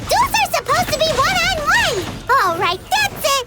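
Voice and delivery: high, loud, outraged battle call; rally your friends into the fight; indignant shout. Acted. Outraged at ambush, rallying allies.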